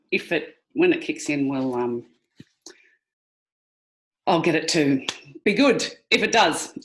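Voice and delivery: ordinary delivery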